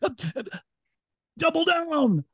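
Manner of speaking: flustered stammer into a high mock battle cry; desperate mock cry. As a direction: cry it out high like a last-ditch defense. Mimics last desperate face-saving attempt.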